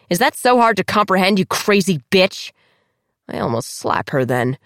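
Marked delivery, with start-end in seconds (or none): 0.1-2.5 snarling. Acted. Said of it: "Diana snarls".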